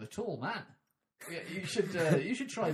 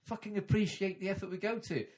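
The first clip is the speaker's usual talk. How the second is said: mock rant; 6 semitones higher than usual. Podcaster scolding listeners in mock outrage.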